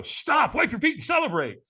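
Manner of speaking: high, urgent imitated command; called-out orders. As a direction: call out like a catcher at the finish. Catchers shout orders at the end.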